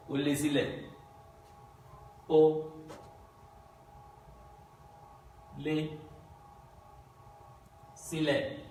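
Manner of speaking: "Ó lé sílẹ̀" with each syllable on its own level tone, spaced by pauses; Yoruba tones. Shown as a demonstration. Teacher showing the tones of okra nobody buys. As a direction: step "Ó lé sílẹ̀" out tone by tone. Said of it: "When you are putting accent".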